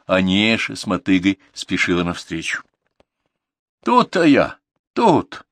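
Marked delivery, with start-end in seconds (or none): 3.8-5.5 calling out. A narrator's reading. Grandma hurrying toward her.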